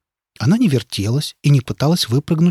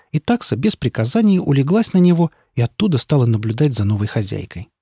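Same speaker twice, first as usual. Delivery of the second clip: ordinary delivery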